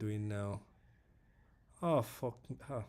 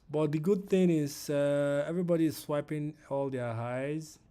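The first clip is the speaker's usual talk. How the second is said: louder, higher upbeat lift; real recovered cheer; upbeat rally; 4 semitones higher, 7 dB louder than usual. Real, not acted. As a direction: bounce back with enthusiasm. Cheers up about swiped highs.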